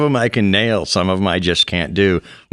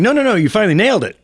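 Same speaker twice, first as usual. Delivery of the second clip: high, fast, thrilled praise; excited praise; 12 semitones higher, much faster than usual. Retold or imitated. Father praising son who nailed the voice, excited. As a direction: rush it out, thrilled.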